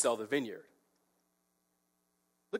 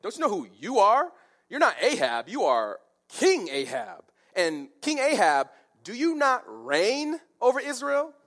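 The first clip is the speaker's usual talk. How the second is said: emphatic, incredulous challenge, rising louder and higher; scolding wife; 7 semitones higher, 5 dB louder than usual. Acted. Wife reminding King Ahab who he is, scolding challenge. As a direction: drive home who he is, punching "King". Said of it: "in other words, she's saying".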